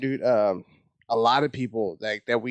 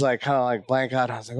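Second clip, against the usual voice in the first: ordinary talk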